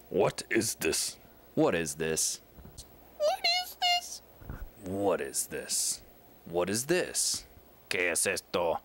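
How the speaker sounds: each take in a new voice and pitch; varied takes. Acted. Actors trying line readings, each one different. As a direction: try the line every way possible.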